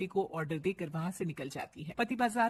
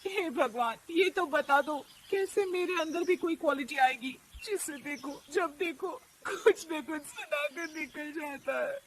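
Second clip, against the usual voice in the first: plea to god; 6 semitones higher than usual. Acted. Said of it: "भगवान की तरफ देखकर कहती है".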